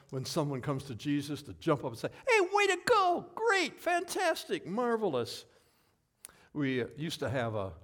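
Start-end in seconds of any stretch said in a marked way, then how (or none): 2.3-5.5 high, loud whoop easing off toward the last word; exuberant cheer. Acted. Preacher cheers someone who comes to Jesus, exuberant high cheer. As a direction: cheer long and high for the newcomer. Models jumping up to cheer converts.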